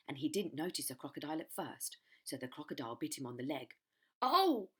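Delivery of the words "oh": high loud yelp; startled elephant. Acted. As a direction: yelp at the bite. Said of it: "said Trunky".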